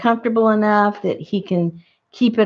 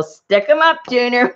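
high playful command to a pet; coaxing the dog; 5 semitones higher than usual. Acted. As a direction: pitch it up to rally the dog. Acts out command to her dog.

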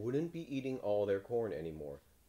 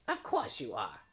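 higher-pitched witch; 4 semitones higher than usual. A narrator's reading. Witch assuring the frog he is changed, witchy voice. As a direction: answer as a storybook witch.

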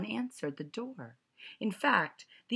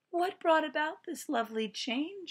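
sweet teacher; 11 semitones higher than usual. A narrator's reading.

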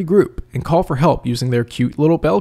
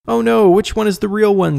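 higher-pitched mock "oh no" line; mock dramatic confusion, pitched up; mock panicked bystander; 7 semitones higher, 3 dB louder than usual. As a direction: put on a hammy, confused plea. Mock stock cartoon line.

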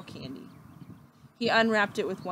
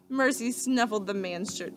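ordinary delivery

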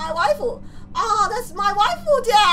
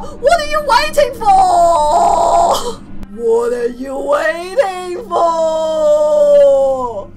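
hyped shout; 5 semitones lower, 6 dB louder, much slower than usual. Acted. Hyping up the new student.

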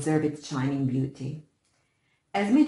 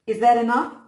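brutal taunt; 6 semitones higher, 10 dB louder than usual. A narrator's reading. Bully over fallen brother.

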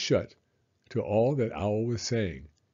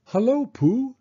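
high, loud greeting; Owl's voice; 14 semitones higher, 8 dB louder than usual. Acted. Owl greeting Pooh at his door, hearty. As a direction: sing out a hearty hello.

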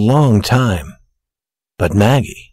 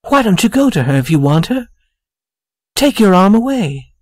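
higher-pitched, questioning woman's voice; lightly voiced upset fiancée; 8 semitones higher than usual. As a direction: press him like a mildly hurt sweetheart. Hurt fiancée suspects another girl.

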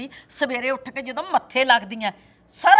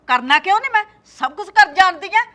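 louder, higher threat; angry boast; 6 semitones higher, 7 dB louder than usual. Acted. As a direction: threaten her with scorn. Mother-in-law threatens. (ਸੱਸ ਦੀ ਗੁੱਸੇ ਭਰੀ ਧਮਕੀ)

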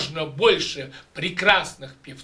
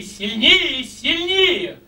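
each "сильнее" climbing higher, pushed harder; demo of stronger sound; 6 semitones higher, slower than usual. Shown as a demonstration. Voice coach shows students a stronger sound, climbing higher. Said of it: "Вот, смотрите, когда я это делаю".

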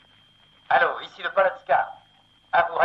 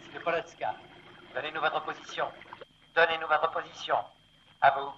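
radio voice; 7 semitones higher, 6 dB quieter, faster than usual. Acted. Ship over radio.

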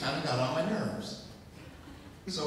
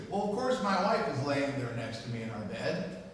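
usual speaking voice

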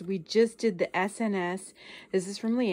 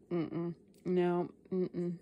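ordinary talk, nothing marked

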